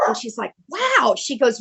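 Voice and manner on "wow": high-pitched impressed wow; high note of admiring surprise; retold wow. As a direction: imitate someone pleasantly amazed. Acts out Stone impressed by answer.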